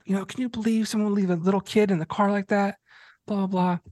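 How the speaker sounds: imitating the woman. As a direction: mimic a prim bystander tutting. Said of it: "the girl's like".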